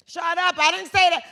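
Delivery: loud, high mock shout. Shouting back at a laughing audience in jest. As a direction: snap it up high and loud, joking.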